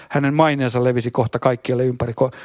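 ordinary talk, nothing marked

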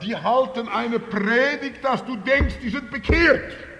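emphatic preaching. Real, not acted. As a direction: drive the point home at full voice. Preacher builds to emphatic point. (eindringlich und laut gepredigt)

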